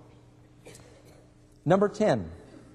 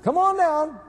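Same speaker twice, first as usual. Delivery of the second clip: shouting upstairs; 12 semitones higher, 4 dB louder than usual. Acted. Drunk husband calling his wife down late at night, mock shout. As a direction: call up to someone upstairs, hammed-up. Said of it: "started shouting upstairs".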